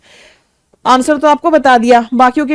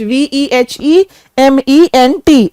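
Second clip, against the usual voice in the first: spelled letter by letter; 4 dB louder, slower than usual. Shown as a demonstration. Teacher spells out the word.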